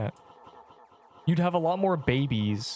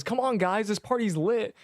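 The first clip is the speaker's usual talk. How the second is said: hyped invitation; 7 semitones higher than usual. Pretend. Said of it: "He says".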